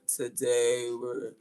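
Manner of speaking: slow droning monotone; a hint of a mopey Eeyore. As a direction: drag it out like a bored donkey. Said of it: "an Eeyore voice".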